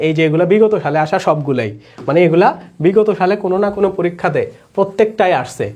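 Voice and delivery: normal talking voice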